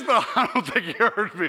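usual speaking voice

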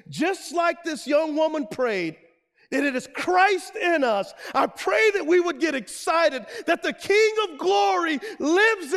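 loud, high, impassioned preaching prayer; fervent prayer. Real, not acted. Preacher prays over students for missionaries of grace, fervent prayer. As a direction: pray it out at full force, building.

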